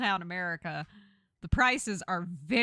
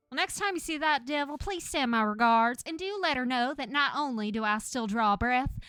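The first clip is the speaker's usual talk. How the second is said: Gretchen, Southern belle; 3 semitones higher than usual. Acted. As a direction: send a pointed message as a Southern lady. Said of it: "sounds like a Southern belle".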